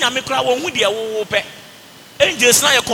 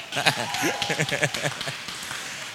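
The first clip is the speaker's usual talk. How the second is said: long, low, soft laugh; low chuckle; 9 semitones lower, 10 dB quieter than usual. Real, not acted. Preacher laughs after mimicking prayers, low chuckle. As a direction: chuckle away after the joke.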